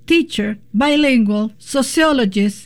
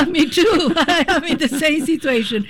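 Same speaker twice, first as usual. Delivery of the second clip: laughing high as she talks; giggling through the words; 6 semitones higher than usual. Real, not acted. Laughing at shared citizenship worry.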